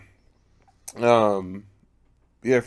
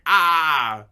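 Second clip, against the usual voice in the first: short loud startled cry; startled yelp; 6 semitones higher, 8 dB louder than usual. Retold or imitated. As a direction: yelp like you got jumpscared. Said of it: "And she's like".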